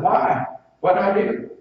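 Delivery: startled, a little defensive; retold surprise. Preacher asking the angry stranger why, loudly surprised.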